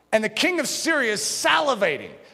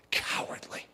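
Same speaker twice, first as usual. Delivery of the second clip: breathy whispered repeat; 10 dB quieter than usual. Real, not acted. Preacher echoing the charge of cowardice, whispered. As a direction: let the word fall as a whisper.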